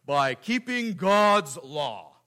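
slow, lightly voiced stern law. Preacher voicing the law to himself, slow and stern. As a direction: speak slowly as the law itself. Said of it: "use my best law voice here".

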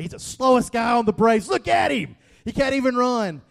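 excited yelling. Retold or imitated. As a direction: yell it like a fan watching the slide home. Retelling a tense ballgame moment.

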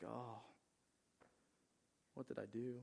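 soft, sinking realization; quiet regret. Retold or imitated. Peter taken back to his denial, quiet regret. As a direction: say it softly to yourself, regretful.

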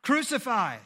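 loud, high crowd shout; shouted crucify. A narrator's reading. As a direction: yell it like a mob. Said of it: "they shouted".

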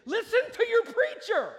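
very high, loud pulpit cry; emphatic shout. Real, not acted. Preacher gives Haggai's final point, emphatic shout.

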